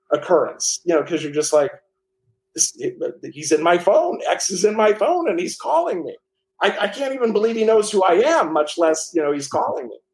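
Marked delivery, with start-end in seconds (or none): 2.5-10.0 retold rush of amazement, pitched higher; stunned disbelief. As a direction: replay the moment you can't believe who is calling. Star calls him unexpectedly.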